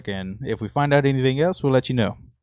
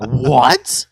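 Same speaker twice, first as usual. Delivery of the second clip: shocked what; 6 dB louder than usual. Retold or imitated. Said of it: "Rappy was like".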